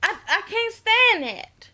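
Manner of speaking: high-pitched mock valley girl, laughing. Mocking the annoying girl's voice, high valley-girl imitation. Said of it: "a little valley girl".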